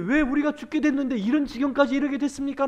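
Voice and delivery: high, loud panicked outcry; frantic disciples. Acted. Disciples waking Jesus in the storm, frantic high outcry. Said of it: "정신없이 막 금방 일어나셨는데".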